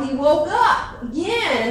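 usual speaking voice

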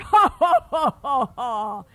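booming ha ha laugh fading; big belly laugh dying away; giant laugh. Acted. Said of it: "Would you laugh like the giant?".

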